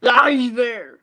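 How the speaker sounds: loud put-on action-star impression; loud, hammed-up star imitation; Arnold Schwarzenegger. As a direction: belt out a big Austrian-accented greeting. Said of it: "Show me the best Arnold Schwarzenegger voice".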